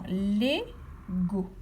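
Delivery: "Lego" drawn out slowly, syllable by syllable. Shown as a demonstration. Teacher to child listening for syllables, slow and drawn out. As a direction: stretch "Lego" so each syllable stands out. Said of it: "À quel endroit entend-on la syllabe lé".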